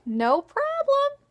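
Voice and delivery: put-on high, slow, proud little boy; cheery child voice. A narrator's reading. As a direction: sing it out like a happy kid. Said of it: "And he's like".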